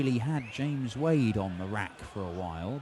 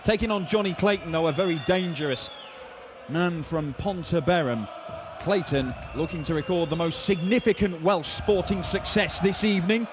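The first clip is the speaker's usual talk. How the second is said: loud, high, hyped-up intro; announcer; 6 semitones higher, 4 dB louder than usual. Acted. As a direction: build the match up like a TV host. Broadcast opening intro.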